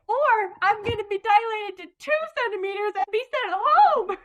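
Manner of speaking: high, loud mock protest; acted protest. Patient retelling her comeback to a friend's prediction, high mock protest.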